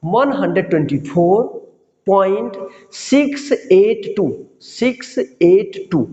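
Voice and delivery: "Six eight टू" slowly, each digit on its own; slow dictation. Shown as a demonstration. Teacher dictating decimal digits slowly to class, slow careful reading. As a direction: spell out the digits slowly for students. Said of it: "जैसे मैंने यहां लिखा".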